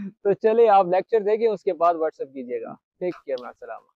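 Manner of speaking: soft, fast-paced promo read; quiet ad aside. Acted. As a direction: slip in the ad quickly and quietly.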